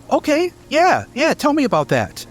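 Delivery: high, eager questioning voice; curious little dragon pressing for answers; Peerless Ruby voice. Acted.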